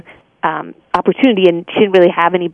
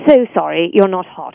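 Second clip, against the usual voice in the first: mock-snooty British accent; British snob, breaking into laughter at the end; snotty British. Voice actor joking in her commercial voice, snotty British. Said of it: "this kind of snotty British".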